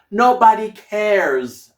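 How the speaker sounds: booming reassurance; loud slow dismissal. Real, not acted. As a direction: wave the worry away with force. Emphatic dismissal of insecurities.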